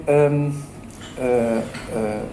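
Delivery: normal talking voice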